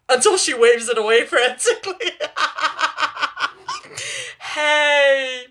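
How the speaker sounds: real giggling through the words; cracking up mid-sentence; laughing. Real, not acted. Reader breaks up laughing.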